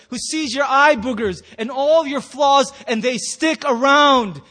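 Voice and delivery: loud, emphatic sermon climax; impassioned preaching. Real, not acted. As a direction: preach it with force, stretching the key phrases.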